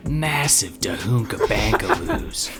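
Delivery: usual speaking voice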